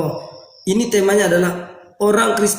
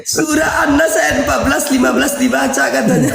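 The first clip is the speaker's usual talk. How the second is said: laughing through pain; 4 semitones higher than usual. Real, not acted. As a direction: laugh so hard it hurts. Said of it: "kebanyakan ketawa gua jadi sakit perut".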